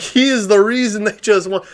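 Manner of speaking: high-pitched, excited bragging; proud boasting. Retold or imitated. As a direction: brag about your friend from the stands. Retold boasting about his friend.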